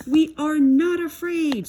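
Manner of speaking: high, loud scream of false courage. A narrator's reading. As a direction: scream it together in fright. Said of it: "screamed Frog and Toad".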